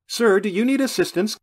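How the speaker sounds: high, loud flight attendant; sudden attendant's voice. A narrator's reading. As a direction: pipe up loudly from behind. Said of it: "came a voice behind me, making me jump".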